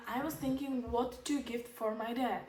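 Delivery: normal talking voice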